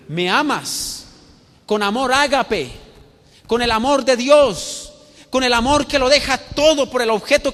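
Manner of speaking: high, loud pulpit delivery; impassioned preaching. Acted. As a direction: drive each phrase home to the whole congregation. Preacher at full force.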